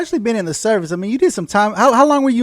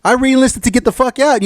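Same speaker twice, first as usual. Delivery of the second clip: exasperated; 6 semitones higher, slower than usual. Retold or imitated. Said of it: "I said".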